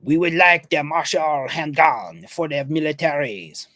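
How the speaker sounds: slow, put-on French accent, lightly hammed; a Belgian gunmaker asking in a mock French accent. Belgian company asking for a military handgun, in a mock French accent. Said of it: "Can I just make 'em French?".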